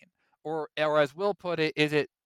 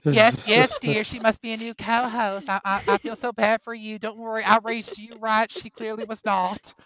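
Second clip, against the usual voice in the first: higher mock mom voice; 6 semitones higher than usual. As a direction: talk down like a smug mother. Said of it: "allow me to portray".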